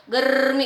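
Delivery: गर्मी as "garrr-mee"; tongue bouncing on the r of गर्मी; correct rolled r. Shown as a demonstration. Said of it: "इस तरीके से".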